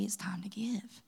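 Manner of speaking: hushed whisper of God's prompting. Retold or imitated. Pastor whispering the word she felt from God. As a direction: breathe it out like a secret.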